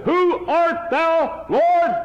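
high, slow, awed cry upward; crying out to the Lord. Retold or imitated. Saul on the Damascus road, a high awed cry. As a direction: Saul cries up from the ground, stunned.

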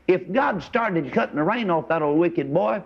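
normal talking voice

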